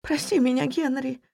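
high, tearful apology; sorrowful sobbing. A narrator's reading. Wife begs her husband's forgiveness, sorrowful and tearful. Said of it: "Горестные всхлипы были ему ответом".

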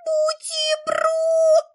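very high, slow tiny creature squeak. Acted. Hungry little creature squeaking for food, very high. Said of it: "пропищал".

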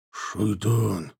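low, soft dying man's voice; feeble, hoarse call from a wounded man; hoarse, weak. A narrator's reading. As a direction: croak it out with little strength. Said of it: "хриплый, слабый голос Нафтали".

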